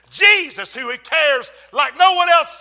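high, driving pulpit shout; full-force preaching. Real, not acted. Preacher urging the church about shattered plans, full-force preaching. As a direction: drive each sentence home to the congregation.

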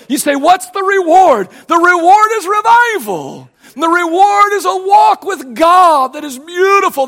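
loud, high, slow preacher's climax; preacher building to a shout; fiery preaching. Acted. Pastor preaching revival to congregation, loud and rising.

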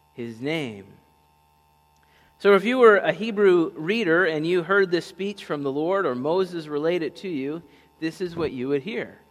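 ordinary talk, nothing marked

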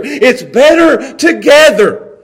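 loud, slow, each word hammered; slow emphatic refrain. Shown as a demonstration. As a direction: land the refrain hard and slow. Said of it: "refrain hammered home".

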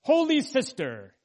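high, loud calling of "Holy sister"; calling out to wife. Retold or imitated. Preacher calling out to his wife, high and loud. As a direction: call out to her, high and loud. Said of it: "pointed to my wife and said".

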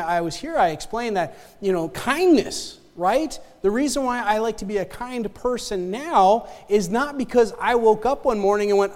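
normal talking voice

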